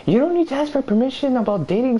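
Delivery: high, mocking imitation of the girlfriend. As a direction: squeak it out like a mocking parody of her. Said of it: "And she says".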